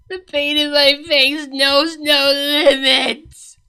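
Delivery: slow, strained voice of a princess in pain; pained Cadance. A narrator's reading. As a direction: drag each word out through the hurt. Cadance's suffering voice, held.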